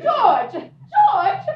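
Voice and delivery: loud, high summons; yelling for George to help; calling out. Real, not acted. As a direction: call him over, outraged. Calling for her escort.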